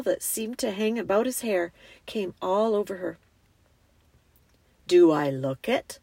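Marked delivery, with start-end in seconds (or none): 4.9-6.0 low, measured lion voice; majestic lion. A narrator's reading. Lion reassures the frightened girl, deep and majestic. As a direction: ask it deep and calmly grand.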